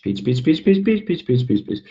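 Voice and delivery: rapid "pitch, pitch" climbing high and back down; quick repeated word sweeping through the voice's range; sliding pitch. Shown as a demonstration. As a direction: run "pitch" up the scale and down. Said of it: "how high or low my voice is".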